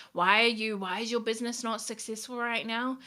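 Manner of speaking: usual speaking voice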